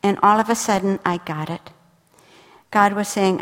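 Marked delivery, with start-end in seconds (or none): none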